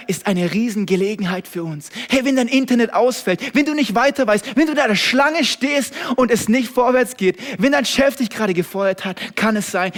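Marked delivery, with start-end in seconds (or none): 2.1-9.9 loud, high, driving sermon build; a preacher hammering each line louder; fiery preaching. Acted. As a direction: preach it at the crowd with rising force.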